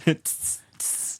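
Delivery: short hissed sizzle; mock burning noise; imitated sizzle. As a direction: mimic skin searing with a sharp tss.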